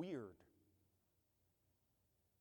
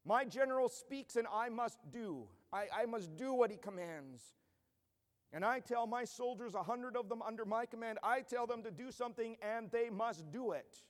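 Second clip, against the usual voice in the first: soldier speaking; 6 semitones higher, 4 dB louder than usual. Acted. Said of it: "And the centurion says".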